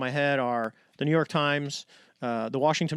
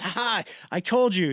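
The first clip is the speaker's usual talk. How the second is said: a mocking told-you-so voice, put on; gloating told you so; 5 semitones higher than usual. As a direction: tease the father, playfully triumphant. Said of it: "She looks at my father and goes".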